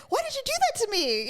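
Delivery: high, quick wounded-animal plea; mock-indignant critter squeal; voiced beaver. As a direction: plead as the betrayed beaver, pitched high. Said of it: "he was like".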